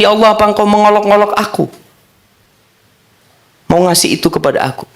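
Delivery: quick, high-pitched astonished question; disbelieving servant. Acted. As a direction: rush it out in amazement.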